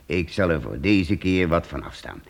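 ordinary talk

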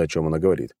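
ordinary delivery

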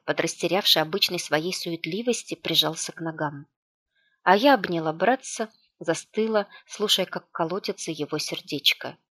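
ordinary delivery, nothing marked

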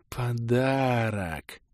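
slow, soft dreamy elf; lightly voiced elf explaining his gift; odd elf voice. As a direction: stretch it out like a strange elf.

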